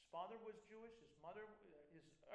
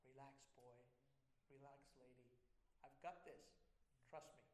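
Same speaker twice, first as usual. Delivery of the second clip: soft, lowered reassurance; calm someone who is anxious; reassuring God; 5 semitones lower, 7 dB quieter than usual. Acted. God reassuring anxious believers, soft and calm. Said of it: "And he's saying".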